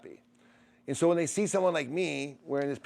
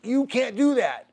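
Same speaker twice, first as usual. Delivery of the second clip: mock scolding; 7 semitones higher, 6 dB louder, slower than usual. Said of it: "because they're like".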